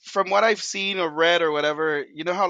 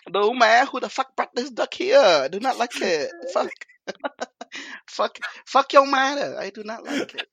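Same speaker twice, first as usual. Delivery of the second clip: loud, laughing mock Vietnamese accent rant; 6 semitones higher, 3 dB louder than usual. Cooking-bit host cursing at the duck in a Vietnamese accent. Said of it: "Vietnamese accent".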